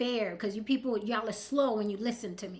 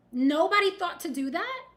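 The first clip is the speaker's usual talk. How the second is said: ordinary talk, nothing marked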